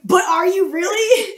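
high, slow sarcastic doubt; sarcastic challenge. Retold or imitated. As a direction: needle him mockingly. Sarcastic retort retold.

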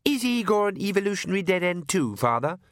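high, petulant whine; vexed daughter. A narrator's reading. Daughter inside the coach asking father, vexed. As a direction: ask it peevishly, high then dropping. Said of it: "the vexed one said".

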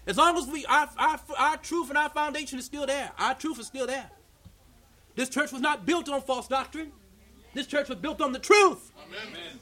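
high, loud preaching. Acted. Preacher rousing the congregation, high and loud. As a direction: drive it home to the church.

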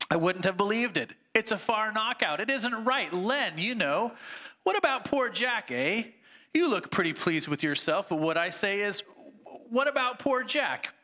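high, aggrieved complaining ghost; indignant ghost. Acted. Sermon reading, the big ghost protests to the bright spirit, high indignant voice. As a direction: whine it like a wronged tough.

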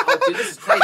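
laughing excitement. Retold or imitated.

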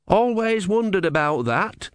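high, slow youthful voice; young novice. A narrator's reading. Young novice piping up from the back of the crowd, youthful voice. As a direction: pitch up as an unsure youngster. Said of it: "said a young novice".

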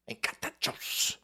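slow, voiceless whisper. Acted. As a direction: whisper it like an echoed catchphrase. Catchphrase repeated in a whisper.

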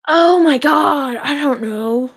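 slow, high "oh my God" caught off guard; flustered surprise. Real, not acted. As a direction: stall like you're stumped. Put on the spot by question.

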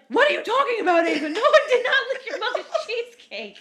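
very high, very loud indignant rant, laugh creeping in; mock outraged yelling. Dad yelling at Ava over false accusation, mock outraged yelling. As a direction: imitate him going off, shrieking his innocence. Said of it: "like, going off again".

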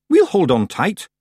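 loud, high Scots character voice; Scottish Feegle. A narrator's reading. Rob Anybody pipes up from the bristles, Scottish. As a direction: pipe up in broad Scots.

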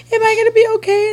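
put-on high, quick pleading child voice; put-on high whimper; imitated tearful child. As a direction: ask it like a worried crying child. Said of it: "Just stop crying".

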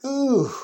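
a high, loud exclaimed ooh; excited ooh. Real, not acted. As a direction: cry out a high ooh on finding it. Preacher finding his passage.

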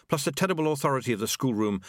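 ordinary delivery

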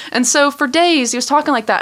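normal talking voice

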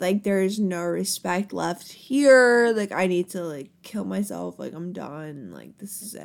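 slow, sinking, fading to very quiet; mock despair. Drunk worker giving up after losing respect, mock despair. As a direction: start slow and let it die away in defeat. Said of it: "I was like".

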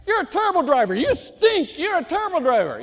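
high, loud roadside rant; acted street-corner shouting; yelling heckler. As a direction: yell abuse at a passing driver. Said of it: "yelling at you".

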